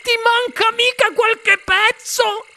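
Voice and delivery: very high, frantic mother's cry; shrieking. A narrator's reading. Mother checks her son is whole, shrieking. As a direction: shriek the questions out. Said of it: "strillò la signora T V".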